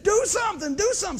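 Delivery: panicked woman begging the pastor; frantic plea. Retold or imitated. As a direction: beg him to stop the fight. Frantic lady during a fight.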